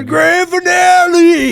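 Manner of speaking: high, drawn-out holler; imitated yell. Imitating guy behind them at fireworks, long high yell. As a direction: stretch it into a hammed-up crowd shout. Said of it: "He probably yelled out nine times".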